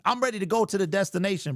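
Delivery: usual speaking voice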